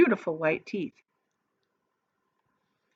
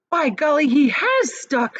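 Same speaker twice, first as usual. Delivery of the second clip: louder, higher dismayed exclaim; dismayed surprise; 8 semitones higher, 6 dB louder than usual. A narrator's reading. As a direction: gasp out the bad news. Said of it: "said Charlie".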